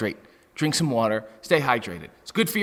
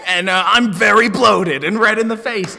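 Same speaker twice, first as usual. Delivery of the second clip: loud, high bellowing tough-guy voice; macho wrestler yell; 6 semitones higher, 8 dB louder than usual. Retold or imitated. Mocking the other wrestlers, a loud macho bellow. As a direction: puff up and roar it like a meathead wrestler. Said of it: "Everyone comes out and they're like".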